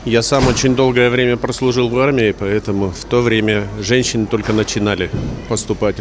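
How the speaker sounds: pitch-shifted voice. Acted. Street interview, altered voice.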